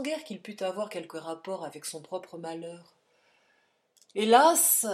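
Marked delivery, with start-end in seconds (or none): 4.1-4.9 loud, high alas; cry of dismay. A narrator's reading. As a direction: cry out in alarm. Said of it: "s'écria le docteur". (s'écrier, alarmé et fort)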